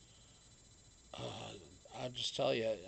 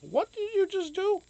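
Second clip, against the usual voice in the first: much higher, louder amazed question; startled high question; 8 dB louder than usual. Real, not acted. Owner amazed at what the dog just did, high surprise. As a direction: react to the dog's surprise trick.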